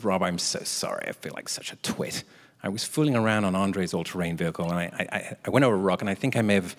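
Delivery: low, soft retold confession; hushed mock contrition; sheepish apology.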